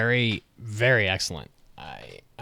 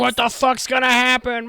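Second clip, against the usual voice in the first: much higher, louder excited cry; excited exclaim; 14 semitones higher, 6 dB louder than usual. Retold or imitated. Co-host chiming in with the quote, much higher excited cry. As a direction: yelp it like a thrilled viewer.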